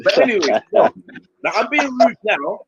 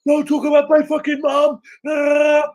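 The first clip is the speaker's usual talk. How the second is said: angry shout; 7 semitones higher, slower than usual. Retold or imitated. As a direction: snap back in a furious yell. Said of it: "I was supposed to be like".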